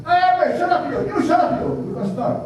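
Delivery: high, bossy shout at the mourners. Retold or imitated. Samoan organizer yelling at chatty mourners, high and bossy. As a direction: point at people and tell them to shut up. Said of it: "he said".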